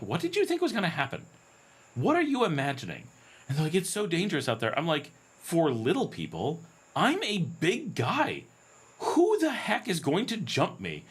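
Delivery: high, disbelieving and a bit exasperated; incredulous retort. Retold or imitated. Telling worried locals he needs no taxi, incredulous and high. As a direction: scoff at their worry as if it's absurd.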